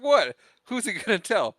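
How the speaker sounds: high loud incredulous what; Marv protesting. Retold or imitated. Marv shrugs off Harry's warning, high and loud. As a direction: squawk it like a blabbermouth.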